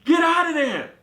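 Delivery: high, loud call to get out; panicked shout. Retold or imitated. Begging friend to leave lion den, shouting. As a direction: yell at her to get out now. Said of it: "I was like".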